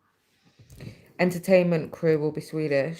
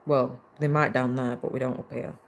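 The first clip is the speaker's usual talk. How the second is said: ordinary talk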